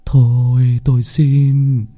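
high, slow, trembling sob-speech; tearful whimper. Acted. Father Đoàn over his daughter's body, tearful whimpering plea. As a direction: plead through tears, voice breaking. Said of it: "thì mếu máo".

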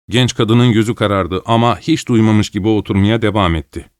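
usual speaking voice

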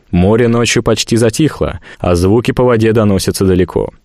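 ordinary delivery

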